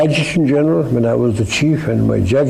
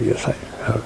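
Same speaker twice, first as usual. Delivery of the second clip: voiceless, much softer murmur; sheepish admission; 2 semitones lower, 8 dB quieter than usual. Retold or imitated. Telling the prime minister he drinks, in a near whisper. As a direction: confess it under your breath, half-guilty.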